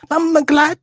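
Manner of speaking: high, loud mock Jamaican squawk; Jamaican accent. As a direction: belt it out in exaggerated patois. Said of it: "talking that Jamaican accent".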